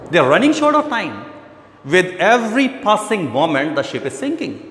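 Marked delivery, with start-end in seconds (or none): none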